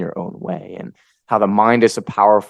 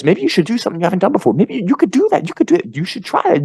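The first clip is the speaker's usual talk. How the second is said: high, eager inner voice; idea voice; 7 semitones higher than usual. Acted. Speaker voicing his idea voice to listeners, eager encouragement. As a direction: pitch it up, urging on one suggestion after another. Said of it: "It's the voice that says".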